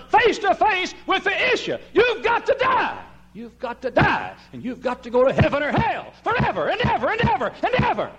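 full-force preaching. Acted. Preacher warning the crowd they must die, shouted. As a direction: thunder the warning.

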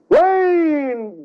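high, drawn-out preaching cry; preacher painting the flood, high dramatic call; dramatic rain. Acted. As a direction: stretch the word like a storm breaking.

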